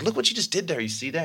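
high, delighted pointing out; high playful excitement; excited teacher to class. Retold or imitated. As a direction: show the kids what happened.

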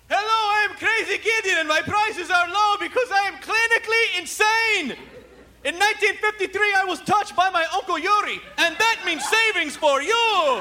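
high-pitched mock madman salesman; hammed-up local TV commercial voice; Crazy Gideon. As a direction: pitch the deals like a deranged appliance dealer. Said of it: "he should just literally come out and go".

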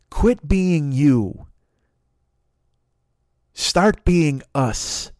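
a grand, slow sign-off; slow solemn slogan. Acted. Comedian closing his set, said very slowly. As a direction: drag each word out slowly as a closing line.

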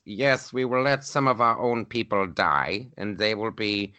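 slow, lightly put-on German villain accent; a hint of a German super villain; mock German villain voice. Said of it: "I have a German accent".

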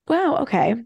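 normal talking voice

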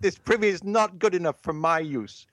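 high, theatrical Elizabethan voice; hammy stage actor. Retold or imitated. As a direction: ham it up like an old stage actor. Mock Elizabethan complaint.

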